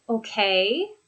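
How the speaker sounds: "Okay" swooping up at the end; encouraging, questioning lift on "Okay"; rising intonation. Shown as a demonstration. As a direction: draw out the end of "Okay" as it rises. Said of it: "that rising intonation".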